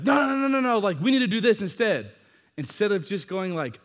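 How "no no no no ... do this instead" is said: controlling protest. Retold or imitated. Controlling friend's objection.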